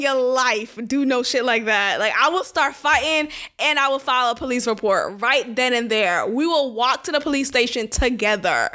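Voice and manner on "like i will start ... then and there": usual speaking voice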